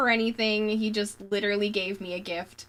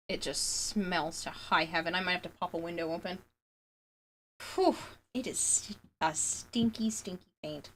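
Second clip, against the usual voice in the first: talking into sleeve; 3 semitones lower than usual. Real, not acted. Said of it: "if I talk into my shirt instead".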